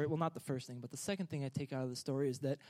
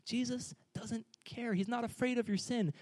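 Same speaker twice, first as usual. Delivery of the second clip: ordinary delivery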